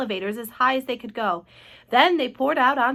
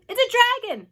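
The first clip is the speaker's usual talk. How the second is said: high, loud alarmed shout; shrieked warning; panicked cry; 13 semitones higher, 6 dB louder than usual. A narrator's reading. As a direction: cry out in terror to the crowd. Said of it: "they cried".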